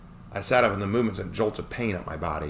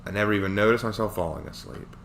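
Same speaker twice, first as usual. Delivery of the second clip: plain reading voice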